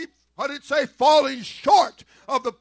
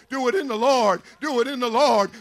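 ordinary talk

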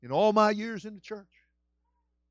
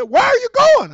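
high, loud startled question; wife calling after him in alarm; 14 dB louder than usual. Retold or imitated. Wife calls as he leaves.

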